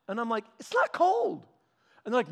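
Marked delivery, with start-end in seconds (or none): 0.6-1.5 put-on high indignant kid voice; kid protest. Kid arguing with the recess teacher, high and indignant. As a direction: whine it like a stubborn grade-schooler.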